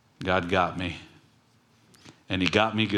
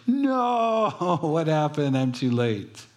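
high-pitched mock wail, laughing; Esau's dismay; 6 semitones higher, much slower than usual. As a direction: ham up a too-late cry. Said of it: "Esau's like".

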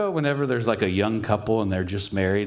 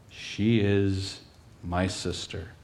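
slow, low and soft; preacher slowly weighing Isaac's lie; somber slow; 3 semitones lower, 3 dB quieter, much slower than usual. Acted. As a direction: let the lie sink in slowly.

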